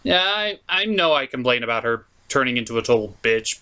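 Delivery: normal talking voice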